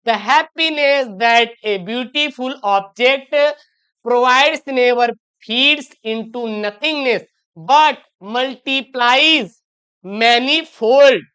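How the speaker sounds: very slow, word by word, every word clear; slow, careful classroom dictation. Shown as a demonstration. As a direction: read it out slowly so pupils can copy. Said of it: "pronunciation करिए".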